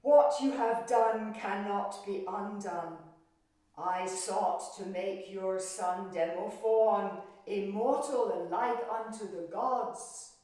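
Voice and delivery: loud, commanding divine voice; mighty goddess. Acted. Demeter to the queen, loud, commanding judgment. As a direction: pronounce judgment with full weight. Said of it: "she said".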